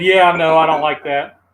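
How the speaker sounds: ordinary delivery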